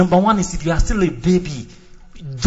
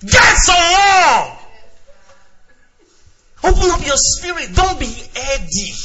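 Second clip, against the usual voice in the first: mock prayer leader shout; 10 semitones higher, slower than usual. Preacher mocking fast-shouting prayer leaders, hammed-up shout. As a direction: ham up a fervent prayer leader.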